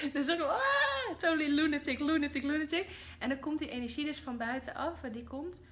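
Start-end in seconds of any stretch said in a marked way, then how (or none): 0.0-2.9 high, loud manic chant; hammed-up frenzy with laughter. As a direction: rave like a lunatic. Mocking greedy humanity, laughing.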